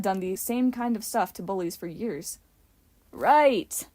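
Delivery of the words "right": loud, commanding yell; imperious yell. A narrator's reading. Sammy ordering his cast around, imperious yell. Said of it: "Sammy yelled imperiously".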